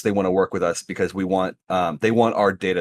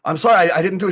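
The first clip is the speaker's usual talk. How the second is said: pleading; 10 semitones higher, 6 dB louder, faster than usual. Retold or imitated. Pleading apology to the officer.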